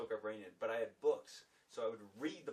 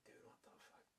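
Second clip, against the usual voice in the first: much softer, breathless whisper; whispered disbelief; 21 dB quieter, faster than usual. Retold or imitated. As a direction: hiss it in shocked wonder. Stunned after fleeing the hostel.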